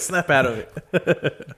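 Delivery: slow laughing drawl. Shown as a demonstration. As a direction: drag it out through a laugh.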